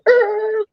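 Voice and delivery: short high siren squawk; imitated police siren. Storyteller imitates the cop car pulling back up, high squawk. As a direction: mimic a cop car's squawk.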